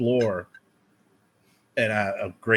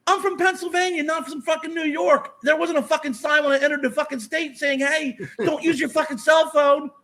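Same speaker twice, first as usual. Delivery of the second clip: very high, strained retort; indignant yelling back; 4 dB louder than usual. Retold or imitated. Driver from Pennsylvania yelling back at a cop, indignant and high. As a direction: yell your defence back in high-pitched outrage.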